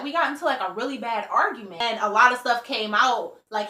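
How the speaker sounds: ordinary talk, nothing marked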